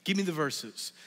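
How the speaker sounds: ordinary delivery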